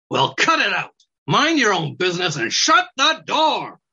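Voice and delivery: rude snappy weasel voice. Acted.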